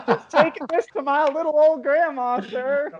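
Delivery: pitched well up, sugary innocence; mock sweet excuse. Pulled-over driver sweet-talks the officer, high and innocent. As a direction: play the harmless grandson for the cop.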